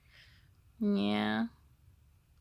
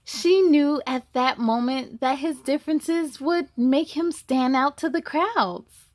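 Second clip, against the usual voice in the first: louder, lively storybook narration; reading to children; 5 dB louder than usual. A narrator's reading. Reading the zebra story to kids, lively and louder. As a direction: tell it brightly to the little ones. Reads the picture book aloud.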